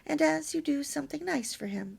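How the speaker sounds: plain reading voice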